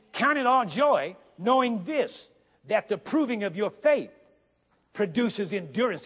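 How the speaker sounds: scripture preached with rising force; preaching. Acted. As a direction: proclaim the verse, slowly. Rereads verse for emphasis.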